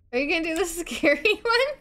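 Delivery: high giggling question; laughing. Real, not acted. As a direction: giggle while asking. Asking to play scary part.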